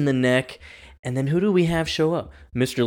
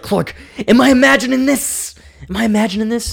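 high, louder mock panic; mock Lex; 10 semitones higher, 5 dB louder than usual. Lex begging Clark to confirm, high hammed-up panic. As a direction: ham up a paranoid Lex pleading. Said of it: "he's like".

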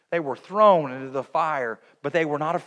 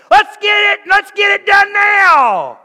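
very high and loud battle cry, slower on the end; rallying shout; 12 dB louder, slower than usual. Acted. Brother coming out of hiding to rally the army: loud shout. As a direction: rouse the troops to charge.

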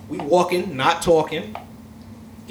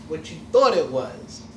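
ordinary talk

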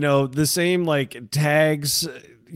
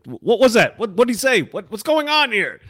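higher, louder puzzled questions; mock bewildered shout; 8 semitones higher, 4 dB louder than usual. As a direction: call out not knowing the call. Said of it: "And you're like".

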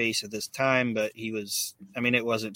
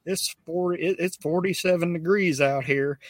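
complaining about the cold in a higher voice; imitated wrestler's gripe, pitched up; mock griping; 6 semitones higher than usual. Honky Tonk Man bitching about the cold outside, mock griping. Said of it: "bitching about how cold they are".

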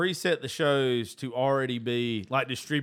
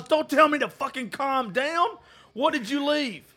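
angry outburst; 9 semitones higher than usual. Retold or imitated. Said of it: "And I was".

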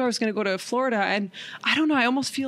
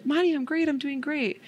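high, chirpy reassurance; lilting, high-pitched mom voice; bubbly mom; 7 semitones higher than usual. Retold or imitated. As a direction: imitate Mom brightly insisting all is well. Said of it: "just her bubbly, beautiful spirit".

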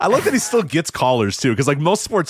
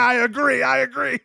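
mock host agreement; 12 semitones higher than usual. Host answering caller.

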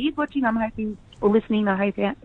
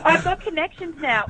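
higher, jokey bragging; mock boast; 7 semitones higher than usual. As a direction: pretend insider boast. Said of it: "No, I'm kidding".

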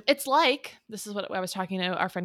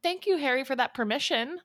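high, mock-grateful; sarcastic, pitched well up; sarcastic thanks; 6 semitones higher than usual. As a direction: thank him with exaggerated fake gratitude. Sarcastic thanks for the quoted permission.

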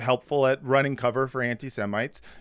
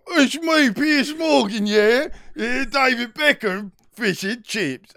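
put-on high, squeaky British caricature accent; mock British accent; 10 semitones higher than usual. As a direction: camp up a pompous Brit in a British accent. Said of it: "That was amazing".

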